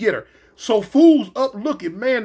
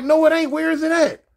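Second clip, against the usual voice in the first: higher-pitched retold retort; higher, insistent comeback in a retelling; arguing back; 7 semitones higher than usual. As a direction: argue back, acted out. Acting out argument over fallen letter.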